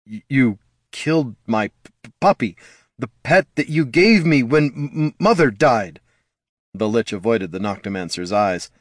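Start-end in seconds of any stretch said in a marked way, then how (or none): 0.1-6.0 hurt accusation breaking into stammers; upset stammering. A narrator's reading. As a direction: catch and stumble on consonants, pitch climbing. Son stammers, upset over killed puppy.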